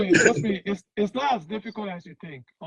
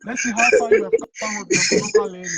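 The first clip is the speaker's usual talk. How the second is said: excited teasing; 11 dB louder than usual. Real, not acted. Host excitedly teasing guest.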